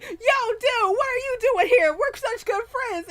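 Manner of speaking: high excited imitation. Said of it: "they're like".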